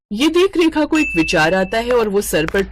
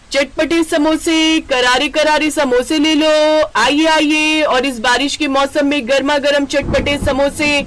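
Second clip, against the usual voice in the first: vendor's call; 7 semitones higher, 4 dB louder than usual. Acted. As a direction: call out to passersby at the stall. Street vendor calling out.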